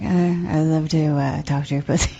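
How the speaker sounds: mock creepy phone voice; imitated creepy caller. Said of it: "He was like".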